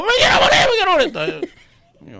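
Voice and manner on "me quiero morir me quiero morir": retold wailing widow. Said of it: "Pero mala grave".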